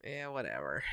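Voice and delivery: a low, quiet whatever; dismissive. Real, not acted. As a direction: wave it away under the breath. Dismissive mutter.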